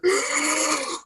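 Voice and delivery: high, drawn-out mock gasp; excited gasp. Fan retells spotting the metal station, excited gasp. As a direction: gasp in hammed-up amazement. Retelling his thrilled discovery.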